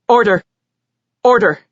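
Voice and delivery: loud order; calling for order. A narrator's reading. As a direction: call the court to order. Judge bangs gavel.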